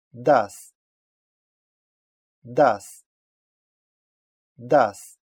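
"Das" with a voiced initial d; teacher's careful "Das", d kept voiced; demonstrated pronunciation. Shown as a demonstration. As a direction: model "Das" as a separate word, starting on a clear d. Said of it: "всегда произносится «д»".

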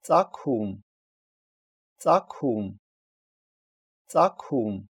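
"Цакум" with a puffed "к"; voiceless "к" mid-word. Shown as a demonstration. Teacher giving an example for learners, puffed "к" in "Цакум". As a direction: say "Цакум" distinctly, breath after the k. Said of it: "читается аку".

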